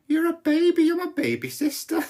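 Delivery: high-pitched mock taunt; teasing put-down. Brother to his baby sister in a meeting, teasing put-down. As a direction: needle a little sister in a high voice.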